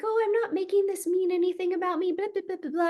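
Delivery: mimicking the client. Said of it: "I had a client yesterday who was like".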